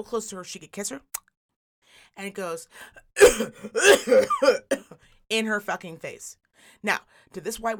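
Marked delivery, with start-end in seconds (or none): 2.7-4.9 loud, deliberate mock coughing; exaggerated fake coughing fit; imitated cough. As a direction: cough hard at someone on purpose. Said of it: "I'm gonna fucking cough on you".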